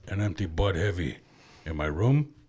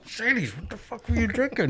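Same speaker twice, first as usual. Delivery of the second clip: shocked teacher; 11 semitones higher, 4 dB quieter than usual. Retold or imitated. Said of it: "They're like".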